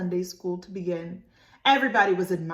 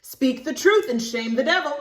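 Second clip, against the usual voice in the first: firm stern warning, louder; 6 semitones higher, 9 dB louder than usual. Acted. Mama warning Libby, stern and firm. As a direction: lay down the law. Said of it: "real stern-like".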